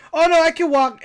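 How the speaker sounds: high, loud re-enacted refusal; hurried-off offer, acted out high; protesting, retold. Nephew declining his aunt's ride, high protest.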